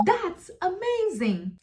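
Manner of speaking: "That's amazing" slow and high, swooping up then down; a roller coaster on AMAZING, for emotion; rise-fall intonation. Shown as a demonstration. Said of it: "rising and falling intonation".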